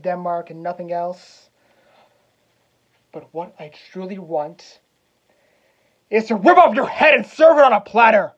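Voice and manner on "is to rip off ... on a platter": furious, full-voiced threats, pitch raised; enraged shouting. Hamlet threatens the uncle who killed his father, enraged shout. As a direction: shout it at him in a vengeful rage.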